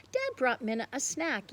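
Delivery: ordinary delivery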